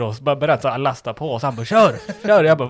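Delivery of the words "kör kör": much higher, much louder barked order; mock coach yell. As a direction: yell it like a pushy gym coach. Retelling the gym guy yelling at him. (härmat högt tränarskrik)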